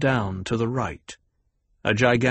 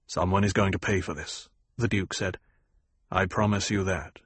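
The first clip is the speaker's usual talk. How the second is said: lower, cold and level, rage kept under the lid; flat, held-down anger; 3 semitones lower, 4 dB quieter than usual. A narrator's reading. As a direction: promise retribution without raising the voice. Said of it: "By the very flatness of his father's voice".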